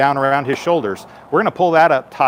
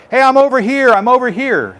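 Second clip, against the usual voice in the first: higher, louder call to be found; calling for help; 10 semitones higher, 4 dB louder than usual. Shown as a demonstration. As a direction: shout so the firefighter can find you. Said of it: "So if you hear us".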